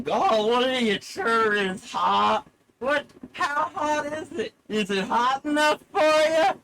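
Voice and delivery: high, loud, drawn-out yokel holler; hammed-up yokel whine at full volume; stupid yokel. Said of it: "some stupid yokel like me".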